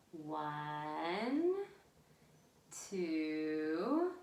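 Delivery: each number slow and separate, with pauses; slow counting. Shown as a demonstration. Librarian counting colors back with children, slowly. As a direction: count slowly for little ones to follow. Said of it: "count them back together".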